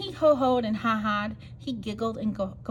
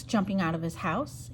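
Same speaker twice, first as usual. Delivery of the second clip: plain reading voice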